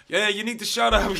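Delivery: loud, high, hammed-up Italian accent; botched French-turned-Italian accent. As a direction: imitate an exasperated doctor in a bad Italian accent. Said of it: "I don't know why I did Italian".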